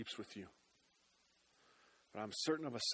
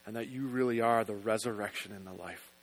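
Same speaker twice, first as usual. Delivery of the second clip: prayer; 3 semitones lower, 4 dB louder than usual. Acted. Closing prayer over music.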